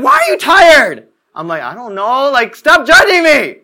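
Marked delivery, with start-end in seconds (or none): 0.0-1.1 high, loud, slow why are you tired; higher, louder quizzical friend; mock surprised question. People questioning him. 1.6-3.6 high loud stop judging me; louder, higher protest; mock defensive whine. Defensive reply.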